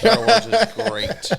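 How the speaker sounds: high, loud, slow laughing protest. Real, not acted. Defends Star Wars, laughing.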